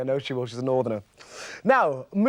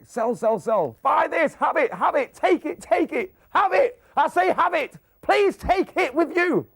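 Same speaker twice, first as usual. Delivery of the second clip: higher, hammed-up hard sell; mock American TV seller begging you to buy; pushy American shopping host; 8 semitones higher, slower than usual. Host mocking American shopping TV, hammed-up pushy selling. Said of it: "The Americans, it's like".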